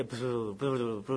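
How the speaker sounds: low, soft mock babble; mock fake tongues. Preacher mimics a young brother's fake tongues, low soft babble. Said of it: "he keeps trying to say".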